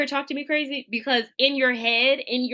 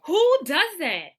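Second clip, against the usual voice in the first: exaggerated disbelief; 7 semitones higher, slower than usual. Disgust at fake supervisor.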